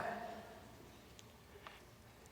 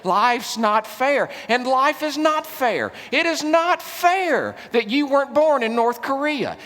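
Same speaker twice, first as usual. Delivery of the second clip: raised, emphatic pulpit voice; impassioned preaching; 7 semitones higher, 5 dB louder than usual. Real, not acted. Preacher confronting the congregation over their blessings, impassioned. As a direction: drive each point home with mounting insistence.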